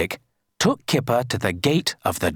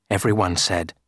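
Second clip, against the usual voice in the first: plain reading voice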